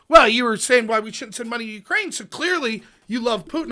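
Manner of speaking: imitated gotcha accusation; mock accusation. Mimics an opponent's accusation.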